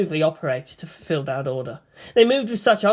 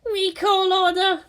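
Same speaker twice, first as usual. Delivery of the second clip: regretful; 13 semitones higher, slower than usual. Acted. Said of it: "Asuka replied, regretfully".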